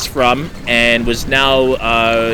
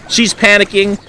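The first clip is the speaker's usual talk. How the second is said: alarmed repeat; 7 semitones higher than usual. Retold or imitated. Repeats it, voice jumps up.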